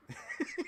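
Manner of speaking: unvoiced burst of laughter; real airy laugh; breathy laugh. Real, not acted. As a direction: laugh silently at the pose. Laughing at pose.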